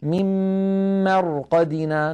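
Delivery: تلاوة مجوّدة بطيئة; Quran recitation. Shown as a demonstration. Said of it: "قالوا".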